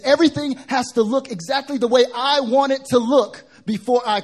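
high, loud, driving sermon delivery; full-force preaching. Acted. Preacher challenging congregation about bargaining with God, loud impassioned preaching.

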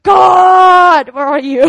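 loud, high wail up to God; anguished shout, stretched on God; crying out. Retold or imitated. Congregant pleading with God in church, crying out. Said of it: "I was crying out".

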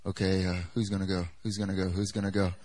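low, hushed uncertain asking around; hesitant disciples. Acted. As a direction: ask warily, keeping your voice down. Disciples nervously wondering who answers.

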